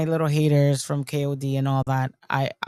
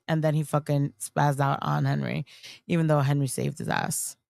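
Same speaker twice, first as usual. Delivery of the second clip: usual speaking voice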